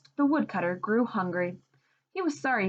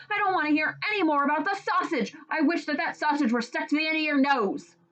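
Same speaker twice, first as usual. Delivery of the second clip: exasperated; 8 semitones higher, faster than usual. Acted. Husband fed up.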